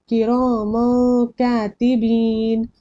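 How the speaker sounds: किरामन कातिबीन slowly, final n of किरामन a nasal hum; correct ikhfa. Shown as a demonstration. Teacher modelling correct pronunciation, slow nasal hold. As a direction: stretch किरामन कातिबीन, noon hidden in the nose. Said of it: "correct pronounce कैसे करेंगे".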